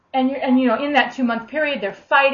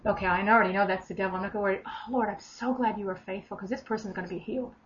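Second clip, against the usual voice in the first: softer, unbothered, quietly confident; calm assurance; 8 dB quieter than usual. Pretend. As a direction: settle it calmly, no worry at all. Modeling a calm confident response.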